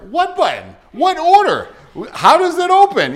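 high, loud, frantic questions; retold panic. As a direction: blurt it out in a rookie's panic. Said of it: "I'm panicking".

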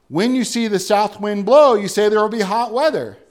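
loud, fervent preaching of scripture; preaching at full force. Acted. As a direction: proclaim it to the back pews. Preacher quoting Jesus.

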